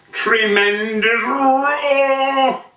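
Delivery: high, long drawn-out "roar"; roaring emphasis. A narrator's reading. Reciter describes the bull's first cry, roaring. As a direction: stretch the last word into a rolling roar.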